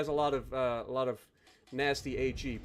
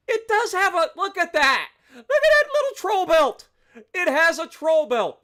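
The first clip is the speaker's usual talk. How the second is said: excited squeal; 10 semitones higher, 7 dB louder than usual. Real, not acted. Marveling at ship's armor belt.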